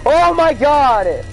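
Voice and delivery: high, loud slow cry; shocked scream. Real, not acted. Jumpscare death in game.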